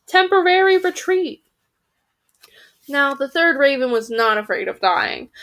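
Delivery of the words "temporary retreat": high, raised battle call. A narrator's reading. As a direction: call it out like an order. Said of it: "he called".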